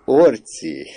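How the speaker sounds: high, loud, amused disbelief; incredulous exclaiming. A narrator's reading. Mother hearing of her son, incredulous exclaiming. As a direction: cry the name out, about to burst. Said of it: "kiáltotta".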